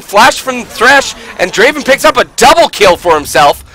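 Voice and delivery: high, loud hype call of the fight; excited shout-casting. Real, not acted. Big fight cast at full tilt.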